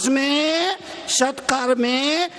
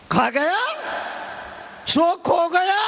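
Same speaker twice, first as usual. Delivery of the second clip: emphatic preaching; 7 semitones higher than usual. Real, not acted. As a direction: call the saying out to the crowd. Repeats saying, raised for crowd. (ऊँचे सुर में प्रवचन की पुकार)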